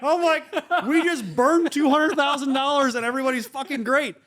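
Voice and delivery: high-pitched laughing glee. Retold or imitated. Laughing retelling of joyful reaction.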